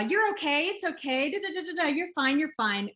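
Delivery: high, hurried sing-song shushing; mock soothing parent. Parent hushing a crying baby, high mock sing-song. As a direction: brush off a baby's crying in a bright high voice. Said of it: "And we do a".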